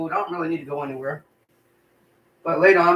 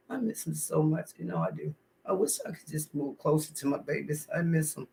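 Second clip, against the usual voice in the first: tearful quiet; 9 dB quieter than usual. Real, not acted. Mother missing her grown children.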